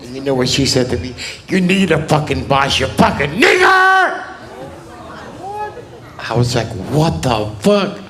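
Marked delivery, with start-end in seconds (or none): 1.5-4.2 screamed slur. Retold or imitated. Woman on the street screaming a slur at him, shrieked. 6.9-8.0 slow, disbelieving reaction; stunned what the fuck. Retold or imitated. Comic reacting to the woman's slur, stunned and slow.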